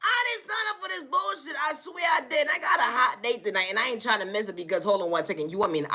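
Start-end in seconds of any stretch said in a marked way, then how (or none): 0.0-1.6 high laughing cry that breaks into a wail; a hammed-up panicked wail. Panicking about no gas.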